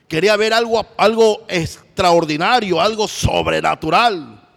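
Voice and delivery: building proclaim. Acted. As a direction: reach for something extraordinary. Preacher builds Elijah's longing.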